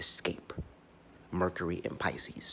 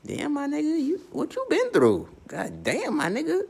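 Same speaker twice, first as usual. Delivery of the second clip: much higher mock voice, loud and amazed; high-pitched mock disbelief at what you've been through; stunned Scorpio; 5 dB louder than usual. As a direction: squeal it up high like a shocked friend. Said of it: "where they're like".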